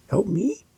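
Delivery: high, soft "Help me" with a rising end; upspeak. Shown as a demonstration. Coach replaying the question ending, pitch going up on "me". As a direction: swing "me" up into a question. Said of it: "I went up".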